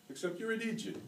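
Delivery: high, hammed-up mock Irish accent; put-on Irish accent. Patrick mocks Charlie in a put-on Irish accent. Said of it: "he says with a put-on Irish accent".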